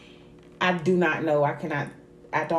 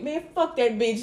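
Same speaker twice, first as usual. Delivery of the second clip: put-on dismissive Charleston accent, higher pitch; 6 semitones higher than usual. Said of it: "her Charleston voice".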